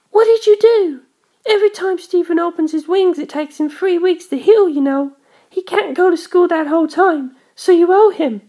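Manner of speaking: put-on a vain girl blaming George; high indignant girl. A narrator's reading. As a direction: tell him off, wide-eyed and offended. Darlington glared at me.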